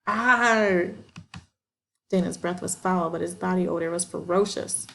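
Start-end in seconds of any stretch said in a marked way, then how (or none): 0.1-0.9 rough growl, high and loud. A narrator's reading. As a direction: let out a brutish growl. Dana growling on top.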